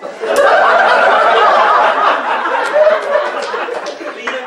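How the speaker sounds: long, loud, high laughter; real roaring group laugh. Real, not acted. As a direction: fall apart laughing.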